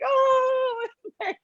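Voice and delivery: high, wailing "oh, I'm so pathetic" through laughter; hammed-up sad whine; mock whimpering self-pity. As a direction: whine it like a pitiful victim, half laughing. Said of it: "I had moments of".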